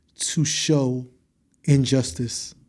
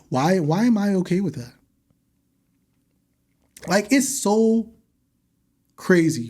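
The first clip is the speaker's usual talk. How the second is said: ordinary delivery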